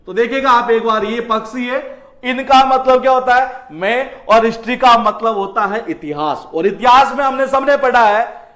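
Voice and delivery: normal talking voice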